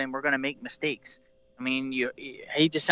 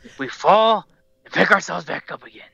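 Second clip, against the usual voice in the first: Batman impression; 2 semitones higher, 7 dB louder than usual. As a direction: deliver the hero's line in a put-on growl. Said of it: "All right, nicely done".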